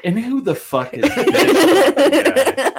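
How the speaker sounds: high, slow, laughing mock outrage; imitating Holly. Imitating Holly swearing at a stranger, high and laughing. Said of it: "Holly's like".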